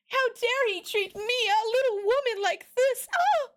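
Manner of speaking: high falsetto damsel in distress; fragile lady voice. Acted. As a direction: play the swooning delicate lady.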